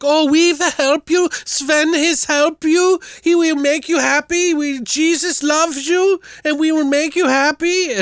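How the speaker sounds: very high, hammed-up Swedish woman; terrible Swedish accent. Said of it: "his wife has the worst Swedish accent in the world".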